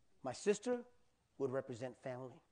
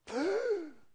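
big, loud gulp of air; acted surfacing gasp; 7 dB louder than usual. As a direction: gasp as if bursting up from underwater. Acts out surfacing for breath.

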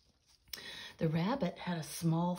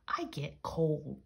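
ordinary delivery, nothing marked

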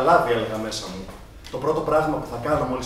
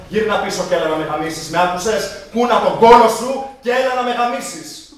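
loud shout rising higher; building loud shout, slowing at the end; shouting after him; 6 semitones higher, 8 dB louder than usual. Retold or imitated. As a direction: yell it after him as he leaves. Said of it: "Εγώ συνέχισα να φωνάζω".

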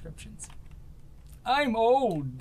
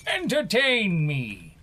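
slow demanding cry; 4 semitones higher, 6 dB louder, much slower than usual. Acted. Mock demanding gladiator cry.

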